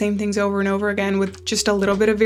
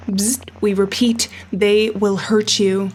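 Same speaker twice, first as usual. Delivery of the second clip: slow, grave broadcast voice; measured public warning, each word weighed; announcement read; slower than usual. Acted. Radio warning the player, read as a slow broadcast.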